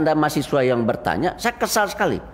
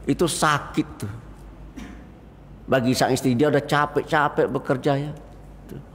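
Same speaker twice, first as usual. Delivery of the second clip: usual speaking voice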